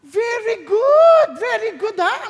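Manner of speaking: hammed-up gushing teacher praising the girl; teacher gushing over a copy-paste answer, hammed-up and slow; fawning teacher. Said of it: "Sumagot yung babae".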